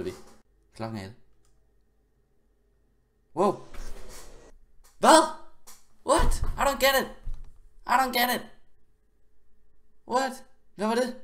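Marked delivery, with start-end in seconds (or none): 3.4-11.2 high, loud, baffled cries; shocked disbelief. Real, not acted. Gamer baffled by a sudden death, shocked outcry. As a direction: exclaim high in total bewilderment.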